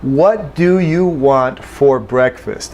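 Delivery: slow, every sound said fully; careful formal reading. Shown as a demonstration. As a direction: read it slowly, no reductions. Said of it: "I'll try it again".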